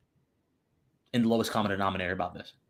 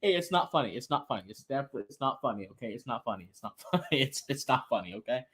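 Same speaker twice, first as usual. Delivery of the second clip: laughing while insisting, fading softer; anger turning to laughter; 4 dB quieter than usual. Real, not acted. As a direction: try to sober up through giggles. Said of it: "insists not funny while laughing".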